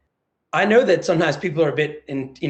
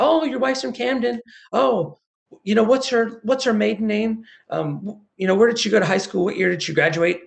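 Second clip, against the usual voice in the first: much higher, excited rapid-fire questions; eager questions; 7 semitones higher than usual. Retold or imitated. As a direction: pepper him with curious questions. Locals asking eager questions.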